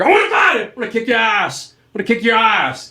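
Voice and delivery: put-on shouty Irish accent, fighter's taunt. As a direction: bark it in an Irish brogue. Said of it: "That's my Irish accent".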